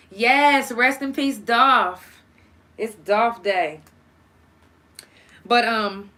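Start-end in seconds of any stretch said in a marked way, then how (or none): 0.1-2.2 loud tribute. Real, not acted. Memorial tribute, called out.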